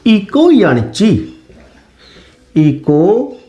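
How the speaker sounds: slow chant of इको यणची; chanted Sanskrit sutra; recited sutra. Shown as a demonstration. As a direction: intone the Sanskrit rule syllable by syllable. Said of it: "इस यण संधि का एक सूत्र होता है".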